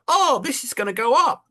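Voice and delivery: high, eager upbeat voice; mock optimist. Investor pitching a rising stock, mock eager. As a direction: chirp it like a hopeful tipster. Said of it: "if you say".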